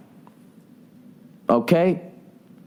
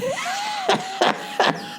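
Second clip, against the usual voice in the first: high-pitched, softer laughing fit; real laugh; 6 dB quieter than usual. Real, not acted. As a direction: burst into high giggling at your own joke. Laughs at own joke.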